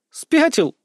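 put-on high-pitched girlish exclamation; indignant outburst. A narrator's reading. Sister rejecting brother's teasing guess, indignant. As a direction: burst out high, overflowing with emotion. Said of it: "Она даже подпрыгнула от избытка эмоций".

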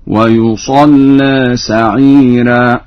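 ويصلى as wa-yusalle, thin doubled lam, final a tilted toward e; light lam, taqlil. Shown as a demonstration. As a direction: recite ويصلى very slowly with a light L. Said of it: "وعلى عدم التغليظ تقليل في ذات الياء". (ويصلى بلام مرققة مشددة مع تقليل الألف)

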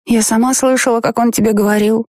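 put-on high, stubborn child tone; little girl voice. A narrator's reading. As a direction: insist like a small girl.

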